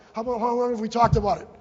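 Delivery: normal talking voice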